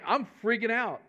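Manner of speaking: ordinary delivery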